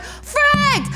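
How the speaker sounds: exasperated cry. Retold or imitated. Traveler facing far-off gates, high exasperated yelp. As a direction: yelp it in drawn-out frustration. Retold frustration at distant gates.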